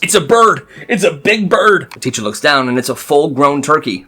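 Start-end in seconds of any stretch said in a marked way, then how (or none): 0.0-1.9 louder, higher alarmed yell; driver yells. Retold or imitated. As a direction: shout the alarm at the kids. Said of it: "The driver yells".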